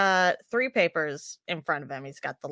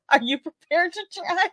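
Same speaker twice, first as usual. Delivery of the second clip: laughing high question; 12 semitones higher than usual. Real, not acted. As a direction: ask it through laughter. Teasing while laughing.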